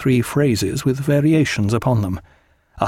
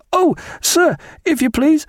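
put-on much higher, anxious young midshipman crying out; a scared boy blurting at the door; frightened midshipman. A narrator's reading. Midshipman at the cabin door, high and frightened. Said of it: "cried a tall, pale, frightened midshipman".

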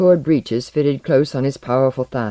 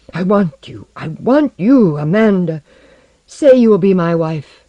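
loud, high melodramatic lover; passionate declaration; 5 semitones higher, 6 dB louder than usual. A narrator's reading. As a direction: build to an urgent, hammed-up proposal. Melodramatic lover's proposal in story.

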